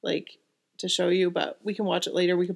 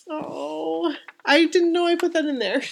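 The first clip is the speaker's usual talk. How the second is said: high, tearful and touched; tearing up; 9 semitones higher than usual. Real, not acted. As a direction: caught off guard, welling up. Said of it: "I'm, like, seriously tearing".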